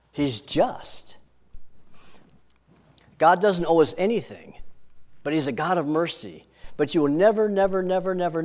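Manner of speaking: ordinary talk, nothing marked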